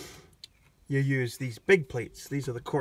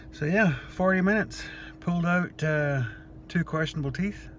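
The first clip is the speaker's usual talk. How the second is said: numb mouth after dental work; talking through a numbed jaw; groggy from anesthesia; 5 semitones higher than usual. Real, not acted. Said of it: "Yes, I'm talking really funny".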